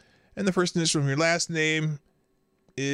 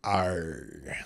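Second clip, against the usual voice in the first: low, soft mock pirate "arr"; pirate growl; 9 semitones lower, 5 dB quieter than usual. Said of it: "National Talk Like a Pirate Day".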